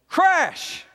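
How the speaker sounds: sudden high, loud burst; shouted crash. Acted. Storyteller breaking in as the lamp falls, loud shout.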